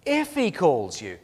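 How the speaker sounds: slow, pointed weight on "If"; stress on IF. Shown as a demonstration. Preacher showing Eli's doubt, pointed stress on "If". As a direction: pick out "If" slowly to show the doubt. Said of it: "There's doubt by Eli".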